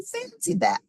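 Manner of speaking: put-on very high old lady voice, amazed. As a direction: pipe it up like a wondering granny. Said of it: "little old lady".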